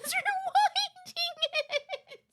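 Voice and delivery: high laugh breaking through the words; breaking into laughter. Real, not acted. Streamer joking about someone rewinding it, helpless laughter. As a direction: lose it laughing mid-question.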